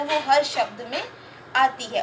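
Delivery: ordinary talk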